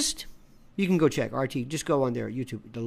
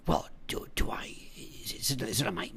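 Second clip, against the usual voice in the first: whispered, clueless caller; mock whisper; 6 semitones lower, 11 dB quieter than usual. Mocking a confused viewer, whispered question. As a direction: hush it like a baffled viewer. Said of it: "Do they say".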